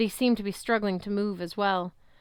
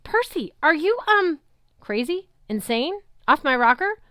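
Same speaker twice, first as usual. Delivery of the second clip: much higher, louder yelp; yelped alarm; 8 semitones higher, 6 dB louder than usual. A narrator's reading. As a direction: squeak in disbelief. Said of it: "Grover yelped".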